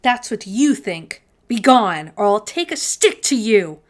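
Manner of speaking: loud, defiant challenge. Acted. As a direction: stand up to the monster boldly. Defiant Herschel confronts the goblin.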